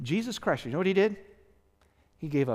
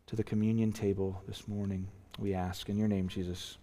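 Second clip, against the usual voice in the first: closing prayer; 6 semitones lower than usual. Real, not acted. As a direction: pray it gently over the congregation.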